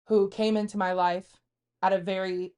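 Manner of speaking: normal talking voice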